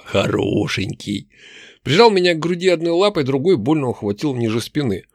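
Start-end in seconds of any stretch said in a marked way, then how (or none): 0.0-1.3 very slow, low orc growl; growling brute. A narrator's reading. Orc slobbering over his captive, slow low growl. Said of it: "рыкнул громила".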